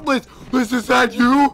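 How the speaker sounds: high, loud hammy call; mock high call. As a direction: shout it out hamming it up. Friend mimics call, high and loud.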